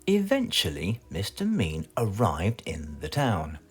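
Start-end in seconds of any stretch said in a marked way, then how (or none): none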